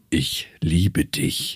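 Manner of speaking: slow, low and heavy "Ich liebe dich"; heavy solemn love vow. Retold or imitated. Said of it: "Das hat so eine Schwere".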